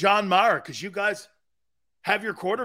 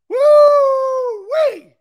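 much higher, much louder fake whoop; mock whoop; 12 dB louder than usual. Host faking joy for Eagles fans with a huge sarcastic whoop. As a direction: whoop like a fan gone wild, tongue in cheek. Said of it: "me talking like this to you".

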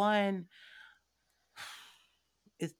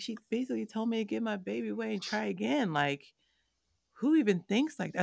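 higher, louder outraged disbelief; upset disbelief; 8 semitones higher, 6 dB louder than usual. Retold or imitated. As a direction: vent to your husband on the phone, hurt and stunned. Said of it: "I was like".

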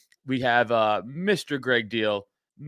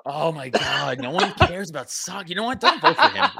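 high, loud joking outrage; mock outrage; 5 semitones higher, 4 dB louder than usual. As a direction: fake indignant rant at a friend.

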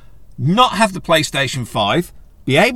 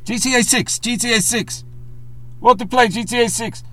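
high mock German accent, repeated chant; a hammed-up German accent, chanting the demand; bad German accent; 11 semitones higher than usual. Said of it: "In my very shitty accent there".

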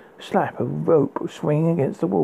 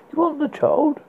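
rising voice; 12 semitones higher than usual. A narrator's reading. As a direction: voice jumping up in shock. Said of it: "his voice rose".

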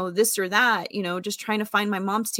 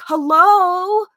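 much higher, loud "Hello? Hello?" into the phone; hurt kid calling out for attention; ignored caller pleading; 10 semitones higher, 6 dB louder than usual. Retold or imitated. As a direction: beg an inattentive parent to listen. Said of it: "And I'm over here on the phone like".

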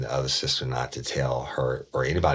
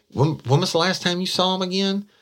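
high, louder, disbelieving question; incredulous challenge; 5 dB louder than usual. Retold or imitated. Detective challenging a suspect, high and incredulous. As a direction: catch her in the lie.

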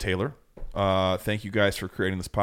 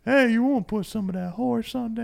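grandma voice. Acted. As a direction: holler like an old grandma. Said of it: "grandma voice".

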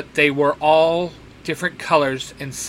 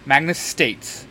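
ordinary delivery, nothing marked

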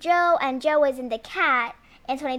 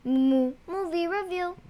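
soft, higher announcer intro; whispered show title announcement; 4 semitones higher, 4 dB quieter than usual. Pretend. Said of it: "Oh, I like that. That was nice".

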